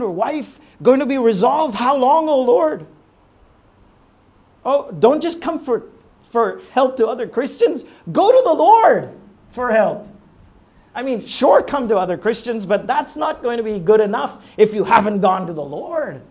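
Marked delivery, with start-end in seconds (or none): 1.7-2.9 higher and louder, pleading; the preacher pleading at full force, loud and high; anguished cry. Shown as a demonstration. 4.6-16.2 louder, higher urgent exhortation; preacher urging the church to go to the Lord, loud; fiery preaching. Acted. Preaching at full force.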